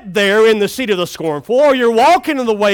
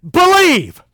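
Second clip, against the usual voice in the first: higher, announcing one word; dramatic reveal; 7 semitones higher, 4 dB louder than usual. Retold or imitated. Preacher reading the screen word like a grand reveal. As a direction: proclaim it like a movie title.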